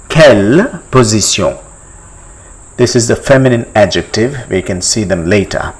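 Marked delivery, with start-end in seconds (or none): 0.1-1.6 "Quelle position" as kel po-zee-syon; French pronunciation. Shown as a demonstration. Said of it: "how do you say".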